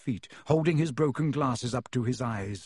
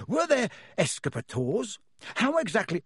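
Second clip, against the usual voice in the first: higher, keen questions tumbling out; eager; 6 semitones higher than usual. A narrator's reading. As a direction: ask like a delighted collector. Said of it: "said Mister Weasley eagerly".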